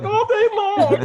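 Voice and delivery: high, slow mock drawl through a laugh; teasing imitation of a friend's complaint; imitating Mara. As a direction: playfully parrot Mara, stretched out and laughing. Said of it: "Mara's like".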